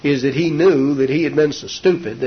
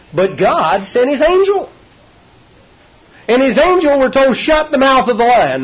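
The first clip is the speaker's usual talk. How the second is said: full preaching; 10 semitones higher, 5 dB louder than usual. Acted. Preacher proclaims God's angel to the congregation, full force. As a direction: proclaim it to the church.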